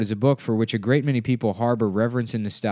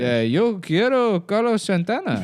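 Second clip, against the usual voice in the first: mock Spanish; 10 semitones higher, 3 dB louder, slower than usual. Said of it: "And then I go".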